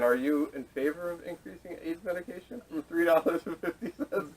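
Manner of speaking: asked through a laugh; barely holding in a laugh while asking; half-laughing as he asks it; laughing question. Real, not acted. Mock devil's advocate question.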